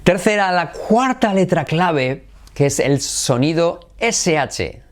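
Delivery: ordinary talk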